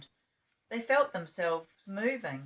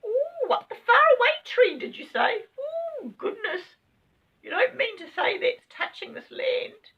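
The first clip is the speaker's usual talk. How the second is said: very high, louder squeaky astonishment; amazed rabbit; 10 dB louder than usual. Acted. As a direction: gasp it out in wonder. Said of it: "cried both rabbits".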